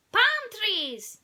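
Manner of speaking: high, loud alien yell; excited Stitch yelling at the scenery. Acted. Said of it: "he yelled".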